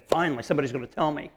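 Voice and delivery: fast, rushed relief; quick mock eagerness; eager listener.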